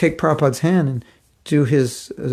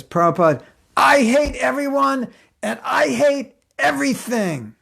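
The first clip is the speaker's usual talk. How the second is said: despairing wail; 11 semitones higher than usual. Retold or imitated. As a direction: whine out that you hate everything. Frustrated wife's outburst.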